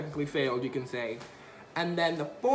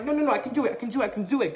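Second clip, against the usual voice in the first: eager pleading; 6 semitones higher, much faster than usual. Retold or imitated. Pleading to continue.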